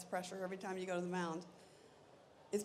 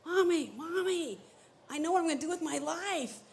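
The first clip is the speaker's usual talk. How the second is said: put-on an excited kid voice pitched way up; 9 semitones higher, 5 dB louder than usual. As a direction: call out to mom like an overjoyed ten-year-old. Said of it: "and I'm going like this".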